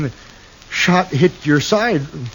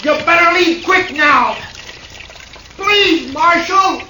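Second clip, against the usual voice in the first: frightened shouting; 11 semitones higher than usual. Acted. Cornered man yells from house.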